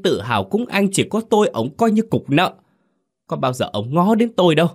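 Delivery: high-pitched furious defiance; bitter shouting back. Acted. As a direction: spit out years of jealousy. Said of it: "vùng đứng dậy rồi cãi lại".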